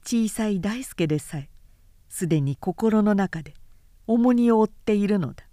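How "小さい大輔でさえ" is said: ordinary delivery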